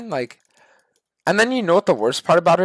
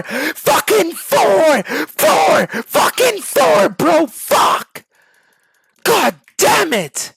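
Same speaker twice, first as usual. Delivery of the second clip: building from a higher fed-up plea to a high full shout; furious yelling; 12 semitones higher, 5 dB louder, much slower than usual. Acted. Streamer screaming at a teammate who won't listen, in a furious shout. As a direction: lose it completely, screaming the answer.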